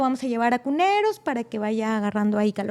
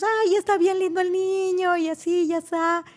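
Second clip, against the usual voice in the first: doting grandparents voice; 7 semitones higher than usual. Retold or imitated. Parents voiced, happy.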